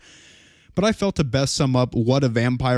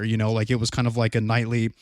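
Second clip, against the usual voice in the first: ordinary delivery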